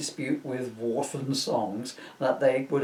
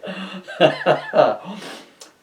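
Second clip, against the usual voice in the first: laughing burst; 6 semitones higher, 6 dB louder than usual. Real, not acted.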